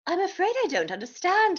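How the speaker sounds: high, polite British duck; British accent. A narrator's reading. Duck politely puzzled by Dodsworth, British accent. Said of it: "How is your accent so perfect".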